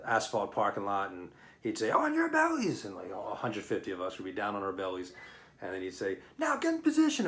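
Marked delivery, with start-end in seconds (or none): 1.9-2.8 much higher, yelled order to the recruits; drill instructor command. Retold or imitated. 6.4-7.3 much higher, yelled order to the recruits; drill instructor command. Retold or imitated.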